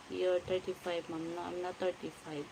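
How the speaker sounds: ordinary delivery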